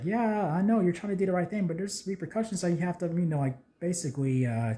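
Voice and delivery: high-pitched mock arguing voice; arguing back. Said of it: "they're arguing back and forth".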